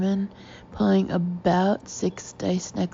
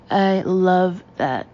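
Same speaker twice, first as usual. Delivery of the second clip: slow, weighty, a bit louder; emphatic; 4 dB louder, much slower than usual. A narrator's reading. Denim-clad woman backing the narrator, emphatic. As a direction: lean hard on every word. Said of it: "says emphatically".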